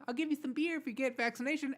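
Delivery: high, fast mocking impersonation; mock the offer as a squeaky bribe; mock Biden. Host mocking the president's beer offer, high fast mock voice. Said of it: "there's that, you know".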